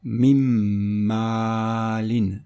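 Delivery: "mim maalin" joined, the m of "mim" hummed long through the nose; slow, sustained nasal m in "mim maalin"; assimilated nasal pronunciation. Shown as a demonstration. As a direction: hold the nasal m between "mim" and "maalin", then draw out the aa. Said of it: "ça va être prononcé".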